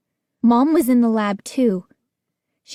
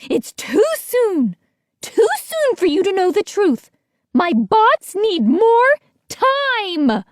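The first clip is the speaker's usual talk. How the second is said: high, slow, seething warning; angry scientist; 10 semitones higher, 3 dB louder than usual. A narrator's reading. Missus B guarding her experiment, seething. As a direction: hold back the boys with fury.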